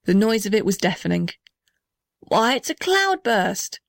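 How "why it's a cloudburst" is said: higher, drawn-out exclamation of alarm; hammed-up cry of amazement; mock shout. A narrator's reading. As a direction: exclaim in storybook astonishment, without real volume. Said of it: "shouted the blue gum".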